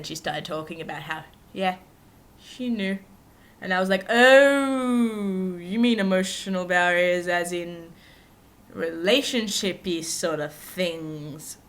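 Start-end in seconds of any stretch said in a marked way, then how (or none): 4.1-11.6 surprised realization. Retold or imitated. Retold reply, drawn out.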